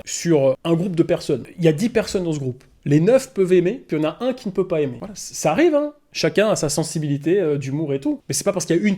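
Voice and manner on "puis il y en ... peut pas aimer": ordinary talk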